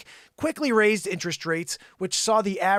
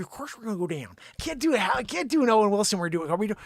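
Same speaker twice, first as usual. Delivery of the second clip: usual speaking voice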